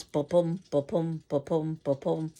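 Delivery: heartbeat rhythm. Shown as a demonstration. Teacher sounding out the iambic beat as a heartbeat, steady. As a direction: tap out ba-pum, ba-pum evenly, weak then strong. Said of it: "like a heartbeat".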